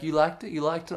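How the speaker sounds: imitated masseuse, high quick questions; eager masseuse. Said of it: "And she's like".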